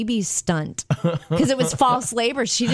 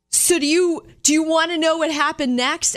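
higher-pitched imitated pastor building up; preacher making point; 7 semitones higher than usual. Pastor to his congregation, imitated rousing question. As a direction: pose it like a pastor working a congregation. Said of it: "trying to prove this really big point".

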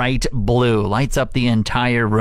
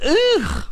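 satisfied high groan. Real, not acted. Relishing savings.

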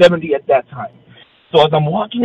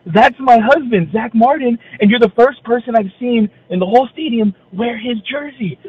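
a hint of a proud wife, pitched higher; 6 semitones higher than usual. Wife explains why she is thrilled, pitched up. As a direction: tell him proudly, a little higher. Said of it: "She's like".

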